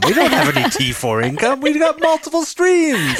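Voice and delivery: high, loud playful outcry, stretched at the end; mock indignant protest. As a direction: protest to the bank in hammed-up exasperation.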